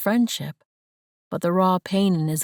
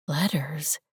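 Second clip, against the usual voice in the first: sniffling; 3 semitones lower, 4 dB quieter than usual. Acted. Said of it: "she sniffed".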